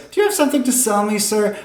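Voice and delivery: high, quick curious woman; woman passerby. Acted. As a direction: pipe up as a curious woman in the crowd. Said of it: "another woman says".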